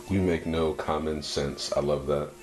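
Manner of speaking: ordinary talk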